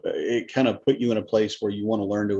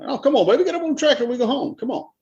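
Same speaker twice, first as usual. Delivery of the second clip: pitched way up, laughing, garbled; mush-mouthed tractor voice; 4 dB louder than usual. Retold or imitated. Husband on the tractor calling his wife, high and slurred. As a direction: slur it like a giddy farmer.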